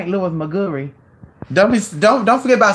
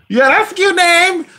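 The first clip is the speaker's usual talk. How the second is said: delighted; 10 semitones higher than usual. Real, not acted. Delighted by the name.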